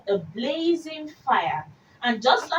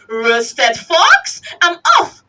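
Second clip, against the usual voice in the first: louder, higher startled fox; alarmed fox yelping in panic; scared fox; 7 semitones higher, 8 dB louder, slower than usual. Acted. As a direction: bolt in fright, squeaking it out. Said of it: "Fox said".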